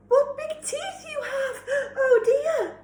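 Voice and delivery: high trembling gasping voice; frightened. Acted. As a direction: gasp and squeak in terror. Red shaking with fear.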